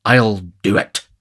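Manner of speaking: slow, grave resolve; grave determination. A narrator's reading. The beadle resolves by the fireplace, grave and determined. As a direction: declare it slowly, with solemn determination. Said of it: "with a grave and determined air".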